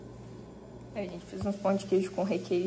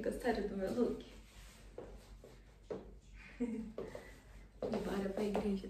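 ordinary delivery

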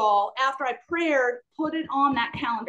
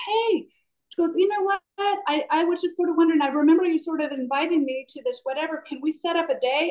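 higher, lightly voiced eager friend; friend's eager question; 6 semitones higher than usual. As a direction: lift the pitch to voice a keen friend asking.

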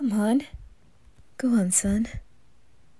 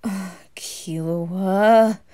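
exasperated; 5 dB louder, much slower than usual. Acted. Exasperated at other child.

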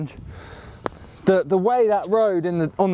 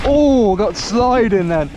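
surprised; 4 semitones higher, 5 dB louder, slower than usual. Real, not acted. Slide on trail.

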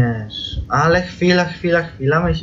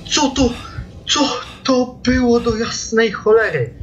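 much higher, shocked outburst; startled shriek; 10 semitones higher than usual. Real, not acted. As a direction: yelp it in sudden fright. Sudden scare in game.

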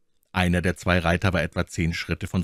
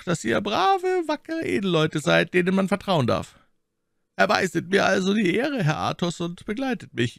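lightly voiced cardinal, smooth and higher; shrewd cardinal; 12 semitones higher than usual. A narrator's reading. Cardinal requesting escort, lightly voiced and smooth. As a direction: flatter and command at once.

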